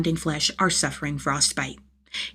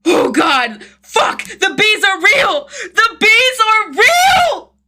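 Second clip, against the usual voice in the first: very high, loud, slow screams of terror; panicked screaming; 9 dB louder, much slower than usual. A narrator's reading. Jason attacked by bees, terrified screaming. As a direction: scream it as the stings hit.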